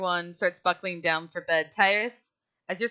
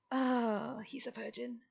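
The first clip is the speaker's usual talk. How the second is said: very soft, dropping aside; hushed realisation; 4 semitones higher, 10 dB quieter, slower than usual. Acted. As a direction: let it sink in, almost under your breath. Character's quiet realisation, acted aside.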